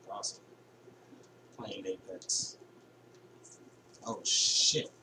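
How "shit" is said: ordinary talk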